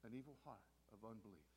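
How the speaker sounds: low, soft, sober repetition; grave, subdued preaching; hushed warning. Acted. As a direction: let it sink in quietly. Same words repeated in a hush.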